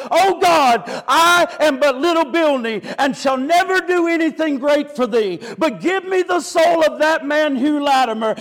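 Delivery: cried out high and loud, easing at the end; ringing, climactic plea to God; impassioned retold prayer. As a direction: preach the prayer at full force. Prayer repeated as sermon climax.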